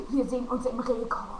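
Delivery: ordinary talk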